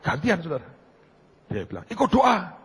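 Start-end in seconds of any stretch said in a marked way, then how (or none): 1.8-2.6 high, raised-voice outburst; loud retold declaration. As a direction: call it out high and sudden. Acts out sudden loud resolve.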